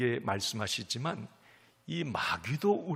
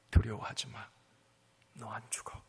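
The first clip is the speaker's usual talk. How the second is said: whisper; 5 semitones lower, 8 dB quieter than usual. Acted. God's gentle reassurance, whispered.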